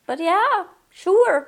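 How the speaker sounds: grudging yes sliding up into a forced sure; reluctant agreement. Acted. Turning down the outfit, then agreeing with a high reluctant sure. Sarcastic agreement to hiking offer.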